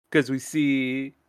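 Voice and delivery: ordinary delivery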